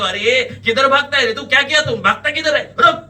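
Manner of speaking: loud, high challenge; accusing shout. A narrator's reading. Man grabs a fleeing suspect, shouting angrily. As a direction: shout to stop a runaway.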